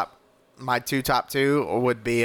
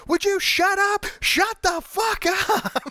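hammed-up angry shout, pitched up; mock yell; much slower than usual. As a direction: yell it in a put-on voice. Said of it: "Damon's like".

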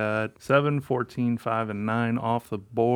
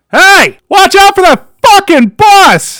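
much higher and louder yelled warning; mock shout; 19 dB louder, slower than usual. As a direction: bellow the warning at the kid. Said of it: "you go up to the same kid".

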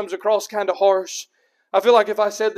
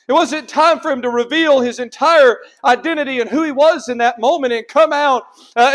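raised, emphatic pulpit voice; insistent, higher-pitched preaching; forceful preaching; 5 semitones higher, 6 dB louder than usual. Real, not acted. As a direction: drive the explanation home with force.

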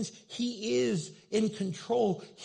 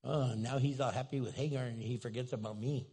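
mild jealous sulk; 7 semitones lower, 7 dB quieter than usual. Retold or imitated. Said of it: "Sarah's all jealous".

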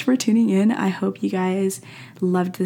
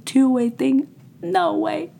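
"Two-way thing?" squeaked high and louder, rising; mock disbelief; 9 semitones higher, 3 dB louder, slower than usual. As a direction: play it like you're shocked by the idea. Mock disbelief at her own point.

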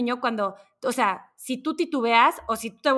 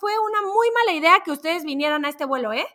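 higher, louder frantic rant into the mic; panicked pilot; 6 semitones higher, 5 dB louder than usual. Retold or imitated. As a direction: rant like a pilot coming apart. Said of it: "el piloto por el micrófono". (piloto gritando por el micrófono a los pasajeros, desesperado)

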